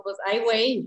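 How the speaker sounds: a light Monterrey regio accent on request; just a hint of the Monterrey regio accent, said casually; a touch of the regio accent from Monterrey. Shown as a demonstration. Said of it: "el puro acentillo".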